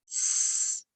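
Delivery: voiceless "S" held as a hiss; hissed s sound. Shown as a demonstration.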